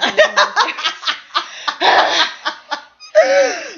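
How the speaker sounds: high burst of laughing; loud laughter. Real, not acted. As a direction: crack up laughing. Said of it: "Sherry just walked up a flight".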